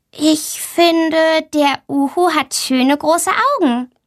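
high, small voice of a shy schoolgirl; shy girl Mia. A narrator's reading. Shy girl answers the teacher in class, high and timid. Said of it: "Mia überlegt".

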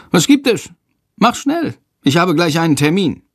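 high, hurried, clipped-off demand; impatient headmaster. A narrator's reading. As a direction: rush the boy, busy man.

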